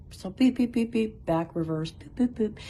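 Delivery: high mock beeping of a plane backing up; playful high beep beep boop; imitated reversing beeps.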